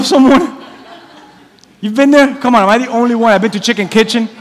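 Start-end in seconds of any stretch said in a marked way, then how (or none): none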